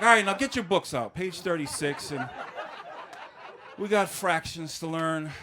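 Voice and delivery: loud, laughing switch to teacher mode. Retold or imitated. Teacher ordering sixth graders back to work, laughing. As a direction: bark out the class orders with a laugh.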